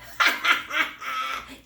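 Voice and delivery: teasing laughter; brothers laughing. A narrator's reading. Brothers laughing at Squeak's jump. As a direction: laugh at the silly cub. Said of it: "Bruno and Leo laughed".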